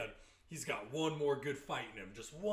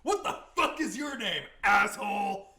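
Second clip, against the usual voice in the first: hammed-up old man shout; 6 semitones higher, 10 dB louder than usual. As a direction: belt it like a furious old man. Voicing old man Richard.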